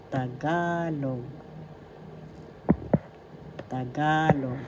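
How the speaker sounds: "Tagalog" slowly, stretching the stressed GA; accent on second syllable. Shown as a demonstration. Teacher modelling "Tagalog" for learners, long stressed second syllable. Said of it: "The accent is in the second syllable, ga".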